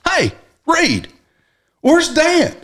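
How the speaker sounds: high voiced dad calling out; imitated dad. Dad asks brother where Dan is, high voice. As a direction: call across the boat like dad.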